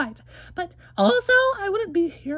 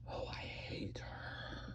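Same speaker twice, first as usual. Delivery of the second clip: softer, breathy hiss of spite; whispered aside; 22 dB quieter, much slower than usual. Acted. Grumbling about the sister as she leaves, whispered. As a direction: whisper it behind her back.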